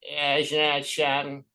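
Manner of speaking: a shaky, fading take on Bob Hope; falling-apart Bob Hope. Retold or imitated. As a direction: play the old host losing it. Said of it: "Bob would be falling apart and I'd be ... going".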